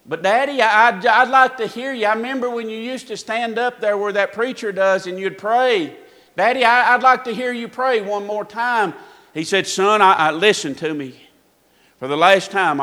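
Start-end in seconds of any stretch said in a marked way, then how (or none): 0.1-8.9 put-on higher, louder, eager child's plea; lightly voiced son begging his daddy; pleading little boy. Son begging his daddy to pray, pleading. 9.7-12.8 louder, firm fatherly rebuke; a father laying down the law; exasperated father. Retold or imitated. Father answering his pleading son, exasperated.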